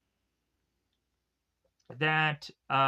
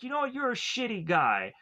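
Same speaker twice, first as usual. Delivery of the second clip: mild mock berating; 7 semitones higher than usual. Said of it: "he just keeps, like, berating".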